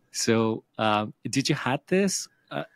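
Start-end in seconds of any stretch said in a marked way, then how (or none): none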